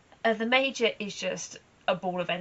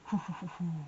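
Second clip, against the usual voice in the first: low horse chuckle. Retold or imitated. To her listeners, copying her mare's low chuckle. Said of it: "she's got such a low chuckle".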